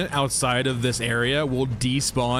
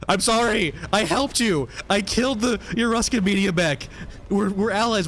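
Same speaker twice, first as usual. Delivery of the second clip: higher, pleading apology; being chased; 7 semitones higher than usual. Real, not acted. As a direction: beg the mech for mercy. Begging hostile mech while fleeing.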